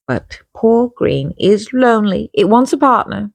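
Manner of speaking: mock pity. Explaining colours to viewers, mock-sorry storybook tone. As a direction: coo over lonely green like a sad pet. Personifying the lonely green colour.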